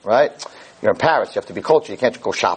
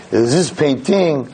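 put-on slow, lightly French-accented guide; a tour guide's hint of a French accent; mock French accent; much slower than usual. Frenchman showing tourists the painting, slow mock French accent. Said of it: "I'll do my little bit in French".

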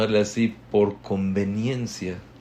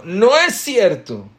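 much higher, loud yelled denial; mock shouting; 10 semitones higher, 7 dB louder than usual. The first person in the fight yelling louder still. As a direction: scream it to top the other one. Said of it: "va gritando más fuerte".

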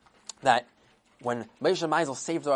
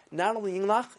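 usual speaking voice

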